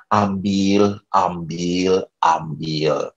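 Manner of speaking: low, sinking repeated offer; mock resignation. Giving away food he cannot eat.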